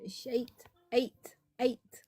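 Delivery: coaching the "eight" vowel; Scottish "shite". Shown as a demonstration. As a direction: sound out "Eight", "Eight" like a teacher. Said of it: "You need to emphasize the eight".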